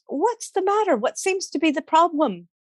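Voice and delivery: lightly voiced worried saint; concerned Bridget. A narrator's reading. Bridget asks the crying people.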